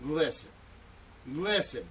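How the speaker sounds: usual speaking voice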